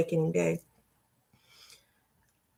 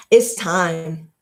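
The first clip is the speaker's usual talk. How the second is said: usual speaking voice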